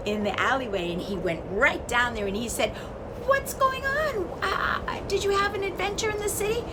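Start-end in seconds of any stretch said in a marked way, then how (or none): 3.3-6.6 very high, airy questioning voice; the wind swooping down to ask; curious wind. Acted. Wind asks the chick.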